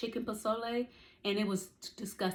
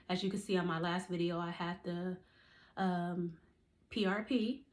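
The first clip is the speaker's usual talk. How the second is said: ordinary talk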